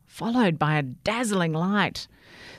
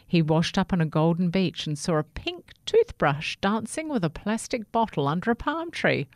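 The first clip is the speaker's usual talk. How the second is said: ordinary delivery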